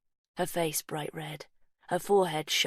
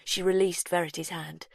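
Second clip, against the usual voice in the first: ordinary delivery, nothing marked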